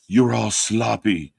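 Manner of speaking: low, slow breathy impression; breathy mock voice. Host mocking wrestler's promo voice, breathy and low. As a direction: drag it out low and airy. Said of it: "In that voice".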